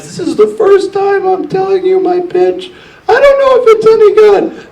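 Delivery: very high squeaky parody voice; mock founder whining about his wart; whiny mock pitcher. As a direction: squeal the excuses like a silly child. Mocking an excusing founder.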